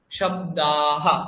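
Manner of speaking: শব্দাহা broken up very slowly and low, ending in হা; sounded visarga. Shown as a demonstration. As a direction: draw out দা in শব্দাহা, then release হা. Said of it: "আয়ের পরে একটা হা". (ধীরে টেনে শব্দাহা, শেষে হা)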